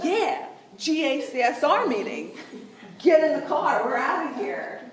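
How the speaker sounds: loud, hammed-up parental blow-up; parent losing it. Parent loses it right back at kids in store, mock furious. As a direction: order the kids out, losing your mind for laughs. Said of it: "you just lose your mind right back with them".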